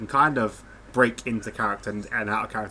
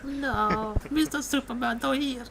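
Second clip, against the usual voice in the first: much higher maid voice; Consuelo impression; slower than usual. Repeating his only maid catchphrase, much higher. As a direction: brush him off like the maid. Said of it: "That's the only phrase I can do".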